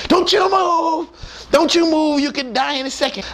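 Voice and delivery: pin him in place; high urgent don't you move; urgent order. Retold or imitated.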